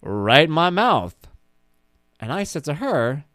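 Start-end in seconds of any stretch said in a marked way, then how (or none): 0.0-1.2 loud, slow sassy punchline; deadpan kid comeback. Retold or imitated. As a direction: land the pun with sass. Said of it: "And she goes".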